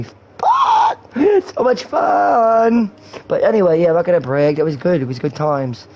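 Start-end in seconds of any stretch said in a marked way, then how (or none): 0.0-2.9 high, loud, drawn-out cheer; giddy drawn-out shout; excited whoop. Real, not acted. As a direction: laugh and crow it out, stretching the words. Boasting about wild times with ex.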